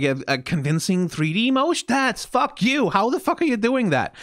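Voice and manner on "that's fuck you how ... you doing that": amazed disbelief. Real, not acted. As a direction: gasp it out in awe. Amazed by animation detail.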